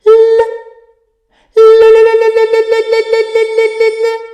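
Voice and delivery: fast high loud L L L; repeated L. Shown as a demonstration. Teacher demonstrating the L with the tongue going up. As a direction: flick the tongue up on "L". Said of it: "My tongue goes up".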